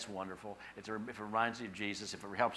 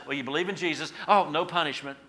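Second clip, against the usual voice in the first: louder, breezy dismissal with a laugh; mock casual God; 6 semitones higher, 8 dB louder than usual. Imagined God letting a believer off, mock casual. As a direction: lightly laugh it off, raised pitch. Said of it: "as if God says".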